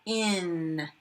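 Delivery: ordinary talk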